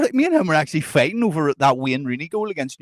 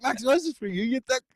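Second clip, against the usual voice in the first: higher, amused scolding; laughing exasperation; 9 semitones higher than usual. Retold or imitated. To drunk Maxwell at the taxi, laughing exasperation. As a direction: tell him off while cracking up.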